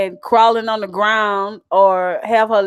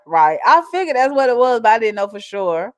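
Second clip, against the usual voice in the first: ordinary talk, nothing marked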